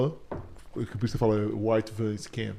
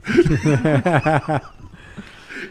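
a big burst of laughter, higher and louder; loud real laugh; 4 semitones higher, 7 dB louder than usual. Real, not acted. Mocking an amateur scam caller with the cohost, loud laugh. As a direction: let a full laugh burst out.

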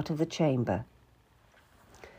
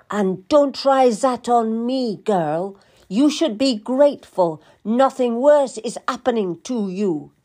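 stern queen, raised and louder; sharp royal rebuke; scornful queen; 4 semitones higher, 4 dB louder than usual. A narrator's reading. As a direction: scold a servant with contempt.

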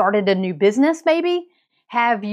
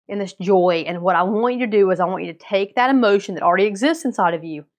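normal talking voice

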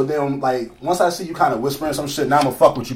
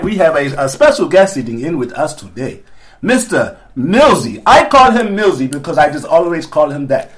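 grand, hammed-up African-accented introduction; ceremonious put-on African accent; 2 semitones higher, 5 dB louder than usual. Host introducing a special guest, grand mock African accent. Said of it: "talk with an African accent".

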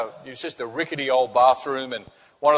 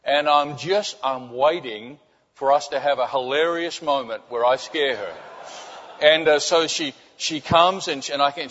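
usual speaking voice

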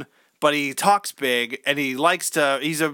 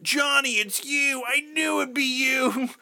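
much higher, slow and soft; eerie childlike voice on the phone; creepy little boy; 9 semitones higher, 3 dB quieter, slower than usual. Retold or imitated.

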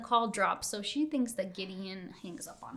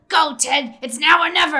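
loud, hammed-up urging; urgent egging on; 5 semitones higher, 15 dB louder than usual. Whisperer pushing Ted to act, acted urgent shout. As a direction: goad him into action at full volume.

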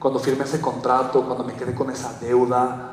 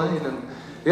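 ordinary talk, nothing marked

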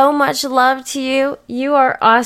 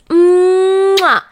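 loud kiss; 9 semitones higher, 4 dB louder than usual. Real, not acted. Blowing a kiss.

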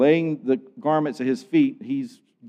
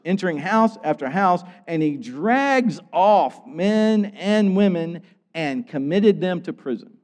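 normal talking voice

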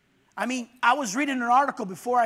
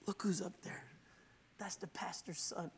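hushed, much softer whisper; 4 semitones lower, 16 dB quieter than usual. Retold or imitated. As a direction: whisper it so nobody nearby hears. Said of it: "Papa says".

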